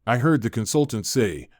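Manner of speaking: usual speaking voice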